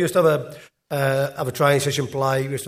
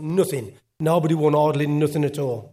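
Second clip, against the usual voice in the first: usual speaking voice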